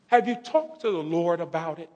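soft, unforced motherly question; hushed, measured query; gentle retold question. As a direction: imitate Mom asking it quietly.

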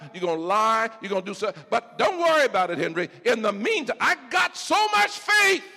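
high, loud, driving sermon; full-force preaching. Acted. Preacher voicing Jesus to Peter, high and loud. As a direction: preach it with fire.

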